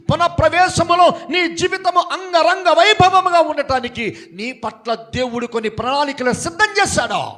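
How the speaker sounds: high, loud proclaiming; shouted preaching. Acted. Preacher proclaiming God's plans to the church, at full force. Repeated phrase, plain then shouted.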